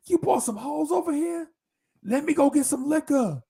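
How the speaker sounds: mock rowdy shout. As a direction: holler it in delighted disbelief. Friend reacts loudly.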